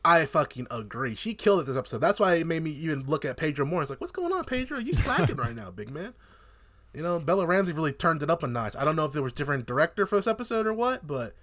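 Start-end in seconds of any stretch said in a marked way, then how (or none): 4.0-6.1 higher-pitched mock chiding; playful call-out, pitched up; mock questioning. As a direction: tease him in a raised pitch, dropping at the end. Mock-addresses the actor he criticises.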